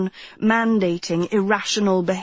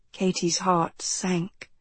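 usual speaking voice

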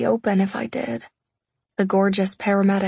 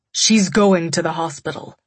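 firm insistence; 3 dB louder than usual. A narrator's reading. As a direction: insist flatly. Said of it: "Wolf argued".

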